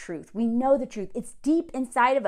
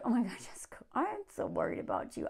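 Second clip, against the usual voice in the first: much softer, mock concerned aside; mock worried hush; 11 dB quieter, faster than usual. Real, not acted. Teasing Jessica about her sensual claim, soft mock worry. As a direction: murmur it in feigned worry. Reacting to sensual bedroom line.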